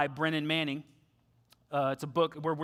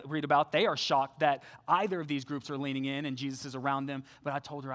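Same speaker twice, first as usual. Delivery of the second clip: ordinary delivery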